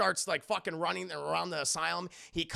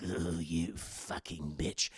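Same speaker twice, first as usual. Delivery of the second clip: very low, slow growled insult; imitated seething under the breath; villain's low grumble; 4 dB quieter, much slower than usual. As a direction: grumble it out resentfully. Joker mutters after taunt.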